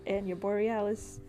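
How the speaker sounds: usual speaking voice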